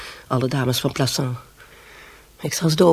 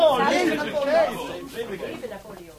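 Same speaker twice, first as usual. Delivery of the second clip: drawn-out echo; 10 semitones higher, 4 dB quieter, much slower than usual. Acted. Echoing the toast, drawn out.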